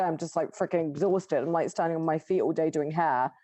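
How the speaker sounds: usual speaking voice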